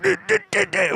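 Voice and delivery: high choked duh sounds, held-in laugh; mock suppressed giggling; stifled laugh noises. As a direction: imitate trying not to laugh. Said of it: "we were like".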